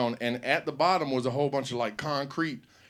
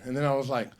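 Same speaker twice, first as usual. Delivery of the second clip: normal talking voice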